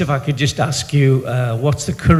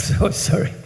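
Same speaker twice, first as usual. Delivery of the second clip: embarrassed laugh; 6 semitones higher, 4 dB quieter than usual. Real, not acted. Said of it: "I'm gonna get emotional".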